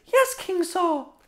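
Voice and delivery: put-on small, high, soft boy voice; shrimpy David. Shrimpy shepherd before the king, small high voice. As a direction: answer meekly in a slow, tiny high voice. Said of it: "He's a shrimpy guy".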